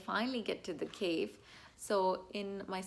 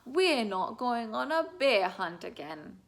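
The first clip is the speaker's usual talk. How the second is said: dramatic storybook refrain; 3 semitones higher, 8 dB louder than usual. Shown as a demonstration. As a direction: proclaim it big for a child. Closing refrain read to a child.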